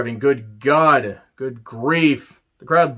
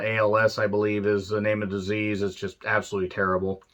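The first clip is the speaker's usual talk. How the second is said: ordinary talk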